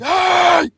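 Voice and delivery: very high, loud mock shriek; imitated freak-out scream. Storyteller imitating the dump owner overreacting, high mock shriek. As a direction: overblown shriek of outrage. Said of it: "He's, like, freaking out".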